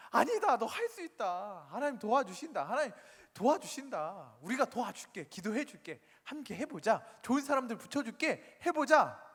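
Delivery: acted-out insistent pep talk; emphatic encouragement. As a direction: persuade a reluctant friend, building to a loud high finish. Acted phone persuasion of reluctant member.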